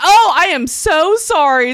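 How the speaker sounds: high, loud, slow mock-contrite sorry; apologizing to the parents after the bowl gaffe; hammed-up apology. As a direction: overplay the embarrassed apology. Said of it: "I was like".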